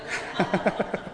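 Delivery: an airless, silent laugh at his own punchline; breathy silent laugh. Real, not acted. Voiceless chuckle. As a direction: pastor laughing at his own heaven joke with no voice behind it, voiceless giggle.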